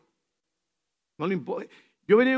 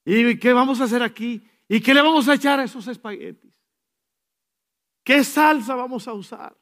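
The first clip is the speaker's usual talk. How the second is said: higher, louder fretting questions; fussing Marta; 5 semitones higher, 6 dB louder than usual. Retold or imitated. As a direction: fuss over the spaghetti sauce. Imitating Marta's kitchen worries. (Marta agobiada en la cocina, más alta y fuerte)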